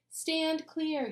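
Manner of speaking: high commanding shout. A narrator's reading. Mister Cooper warning before spraying, high shout. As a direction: bark the order high. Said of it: "he shouted".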